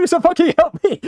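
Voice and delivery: high laughing squeal of help me; high giggling plea; laughing mock plea.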